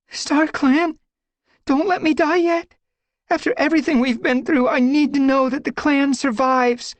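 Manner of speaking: very high, loud desperate plea, rushing; desperate prayer. Acted. Squirrelpaw praying to StarClan as the forest falls, desperate. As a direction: beg StarClan for your life.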